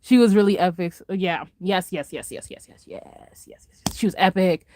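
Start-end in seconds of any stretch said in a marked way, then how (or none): 1.6-3.7 soft rapid repeats dropping into a whisper; dwindling to a whisper; fading gushing yeses. Real, not acted. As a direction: let a string of yeses trail away to breath. Gushing repeated yes dies away.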